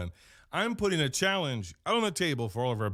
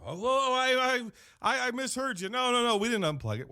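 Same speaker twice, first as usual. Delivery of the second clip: higher, stammering nervous cover-up; flustered backpedal; 11 semitones higher than usual. Retold or imitated. Manager lying to corporate on phone, flustered high. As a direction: squeak out a guilty denial. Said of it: "And I go".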